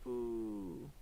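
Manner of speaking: low, soft drawn-out "boo"; imitated zoom noise. Streamer narrating the cool animation, low imitated noise. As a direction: voice a quiet, low sound effect.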